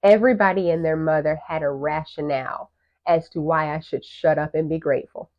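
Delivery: normal talking voice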